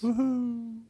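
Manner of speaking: a little falsetto whoop; high woohoo. Real, not acted. Cheering the segment.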